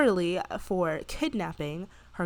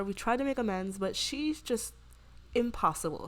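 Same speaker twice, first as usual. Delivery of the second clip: ordinary talk, nothing marked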